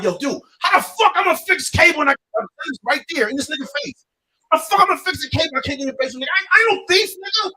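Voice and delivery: high, loud, fast indignant rant; heated protest. Retold or imitated. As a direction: argue back hard at the landlord. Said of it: "I'm like".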